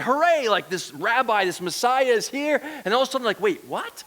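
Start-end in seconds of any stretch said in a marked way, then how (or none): 0.0-2.6 higher-pitched cheer of an excited onlooker; excited, high-pitched hooray; excited crowd. Acted. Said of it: "They're all excited". 3.4-4.0 mock-indignant complaint, pitched up; higher-pitched, incredulous crowd grumbling; grumbling and complaining. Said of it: "so they're grumbling and complaining".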